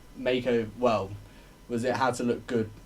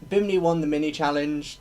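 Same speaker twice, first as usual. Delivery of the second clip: ordinary delivery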